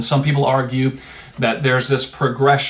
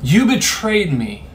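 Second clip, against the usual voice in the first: mock-outraged, higher-pitched reproach; drawn-out, raised-pitch indignation, acted; indignant accusation; 6 semitones higher than usual. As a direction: act out a wounded, accusing outburst. Imagined angry accusation from Jesus.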